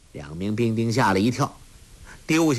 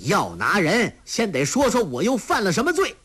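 higher, fast, challenging; defiant shout; 9 semitones higher than usual. Acted. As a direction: demand to know the charge. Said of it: "喝道".

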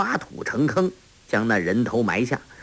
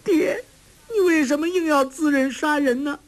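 very high, tearful daughter's wail. A narrator's reading. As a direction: choke out a high-pitched plea to father. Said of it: "黄蓉哽咽道". (带着哭腔高声哽咽)

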